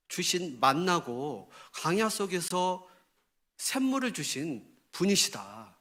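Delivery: ordinary talk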